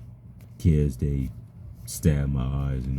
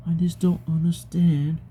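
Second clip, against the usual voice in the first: high voice; 11 semitones higher than usual. Real, not acted. High voice while retelling bus story.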